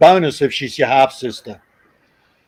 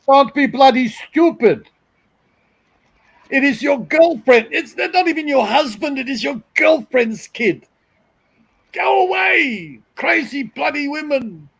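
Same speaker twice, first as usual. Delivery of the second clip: put-on German accent; 10 semitones higher than usual. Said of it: "He was definitely German".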